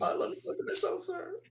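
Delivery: high squeaky put-on recruit voice; comic high-pitched yes-sir; mock soldier reply. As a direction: answer like a cowed private, voice way up. Player answers sergeant in character.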